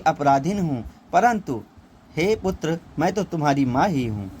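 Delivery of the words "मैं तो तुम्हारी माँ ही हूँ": ordinary delivery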